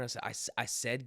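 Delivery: ordinary talk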